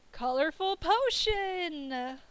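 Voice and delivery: slow, stretched, delighted exclaim; excited exclaim. Acted. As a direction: draw it out with a spark of idea. Sudden idea exclaimed.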